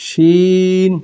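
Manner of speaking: শিন with a thick, full sh sound; heavy শিন. Shown as a demonstration. Teacher contrasting heavy শিন with সিন. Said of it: "এটা মোটা হবে".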